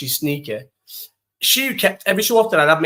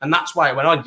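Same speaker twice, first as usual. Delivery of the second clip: ordinary talk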